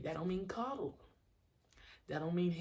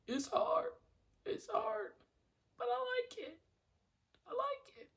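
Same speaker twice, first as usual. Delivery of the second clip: tiny, very high-pitched voice; squeaky confession. Real, not acted. Coach admitting the nonstop grind, squeaky. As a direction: squeak it out in falsetto.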